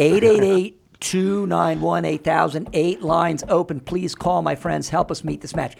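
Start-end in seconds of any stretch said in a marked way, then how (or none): 0.0-2.7 slow emphatic. Real, not acted. Drawn-out phone number appeal.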